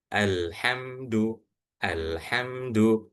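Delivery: slow recitation with a clear, unhummed m in "Alhamdu"; clear izhar reading. Shown as a demonstration. Quran teacher showing students how izhar syafawi is read, "Alhamdu" slow and clear. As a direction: pronounce the m of "Alhamdu" distinctly, drawing the syllables out slowly. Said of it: "Cara bacanya jelas".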